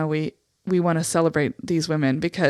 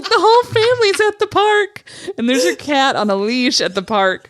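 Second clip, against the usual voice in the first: high, loud gushing voice; mock proud cat mom; 8 semitones higher, 6 dB louder than usual. Friend mimicking Rita bragging about her cat, gushing high and loud. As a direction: gush about the cat picnic, much higher and louder. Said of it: "You're like".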